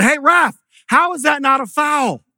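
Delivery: re-enacted indignant holler, pitched high; retold yell. Parent jumps up at the referee over an uncalled foul, yelling in protest. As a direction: call out a protest like an outraged fan. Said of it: "kinda yelled that in my inside voice".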